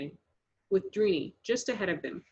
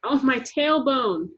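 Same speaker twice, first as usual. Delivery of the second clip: pained cry; 5 semitones higher, 5 dB louder, slower than usual. Acted. After falling.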